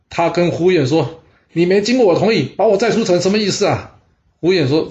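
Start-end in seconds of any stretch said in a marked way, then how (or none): 1.5-4.0 high-pitched indignant challenge. A narrator's reading. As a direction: confront him, pitch raised in protest. Tricked master confronts his retainer. (高声调地愤愤质问)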